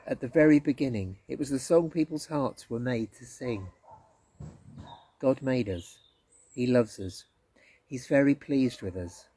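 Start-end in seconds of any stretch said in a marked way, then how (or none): none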